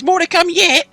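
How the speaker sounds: very high, loud gloating troll; troll Tom gloating. Acted. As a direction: gloat over the catch.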